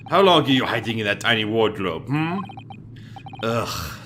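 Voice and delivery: normal talking voice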